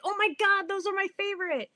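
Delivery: high delighted fangirl gush; gushing excitement. Retold or imitated. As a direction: gush like you found a soulmate. Gushing over shared favorite.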